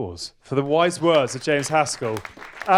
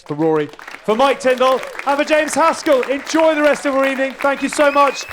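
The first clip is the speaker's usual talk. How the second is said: loud, high call to the crowd; rally the room, much louder and higher; host rousing the live audience for applause with a loud, high call-out; 12 semitones higher, 6 dB louder than usual. Real, not acted.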